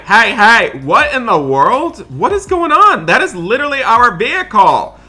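usual speaking voice

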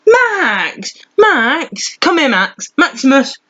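high, sing-out call to a pet; calling the dog. Real, not acted. Owner calling Max to come here, high-pitched calling. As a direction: pitch it well up to summon the dog.

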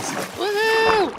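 high, loud cheer; celebratory whoop. Real, not acted. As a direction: whoop for a teammate's find. Celebrating teammate's cocoa find.

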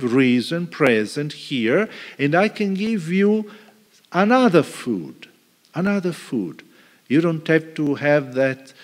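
ordinary delivery

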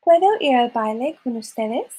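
usual speaking voice

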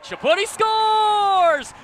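drawn-out shouted goal call; long goal yell. Real, not acted. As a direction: stretch scores long and loud. Goal call loud held.

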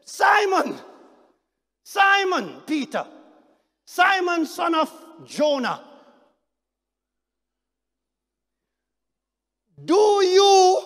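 loud, high, pleading call of Peter's name; Jesus crying out to Peter, high and loud. Acted. Jesus singling out Peter among disciples, loud high call.

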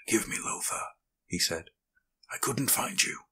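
soft grieving whisper. Acted. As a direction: whisper to a dying friend. Kneeling by dying friend.

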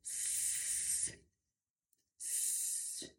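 S as a long, unvoiced hiss; prolonged S. Shown as a demonstration. Teacher demonstrates the juicy English sound, long hissed S. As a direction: hold the S, juicy and sustained. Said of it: "zawsze przytrzymywane dłużej".

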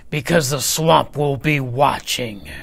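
loud, put-on strict teacher voice; menacing Miss Swamp. A narrator's reading. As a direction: warn the class with weight on each word. Miss Swamp warns class in story.

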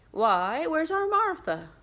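cheerful father calling. Acted. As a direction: call out for the missing daughter. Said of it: "cried Bob Cratchit".

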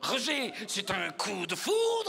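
slow, drawn-out Belgian French; Belgian accent. Acted. Oula declares love to Roger, Belgian accent. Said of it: "c'est mon accent belge".

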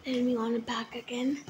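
whispered aside while busy. Real, not acted. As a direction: whisper it to yourself. Muttering while fixing TV.

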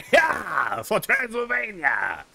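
laughing rallying shout, drawn out; battle cry. Acted. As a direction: yell it like a charge into battle. Charging in with a shield.

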